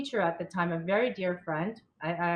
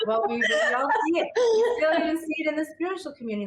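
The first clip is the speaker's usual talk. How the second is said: real laughter breaking through the words, higher and louder; laughing and crying; 8 semitones higher, 5 dB louder, faster than usual. Real, not acted. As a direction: talk through a fit of laughter. Laughing while crying after a joke.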